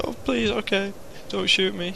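high-pitched mock-frightened plea; pleading hostage. Frightened receptionist begs the gunman not to shoot, high mock pleading. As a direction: beg for mercy in a high, hammed-up voice.

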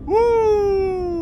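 loud high laugh and whoop. Real, not acted. Laughing at own pun.

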